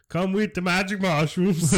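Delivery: high, loud mock northern accent; hammed-up old northern English accent. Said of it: "probably sound a little bit more nor-, northern".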